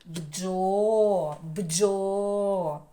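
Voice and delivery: бджо loud with a long-held о; stretched syllable. Shown as a demonstration. Teacher shows the vowel of the first syllable, бджо loud and held. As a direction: draw out the о in бджо, twice. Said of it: "голосний".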